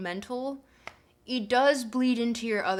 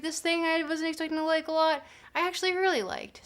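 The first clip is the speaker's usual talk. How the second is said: quoted surprised self; 6 semitones higher than usual. Retold or imitated. Imagined friend, surprised delight.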